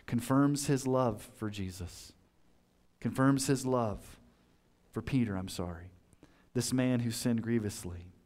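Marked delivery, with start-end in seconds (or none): none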